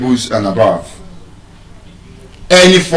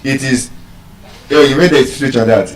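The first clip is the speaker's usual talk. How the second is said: usual speaking voice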